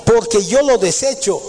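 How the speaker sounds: high, slow, intoned Bible reading; solemn scripture reading. Acted. Preacher reading God's words to the congregation, slow and solemn. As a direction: lift each phrase slowly like holy words.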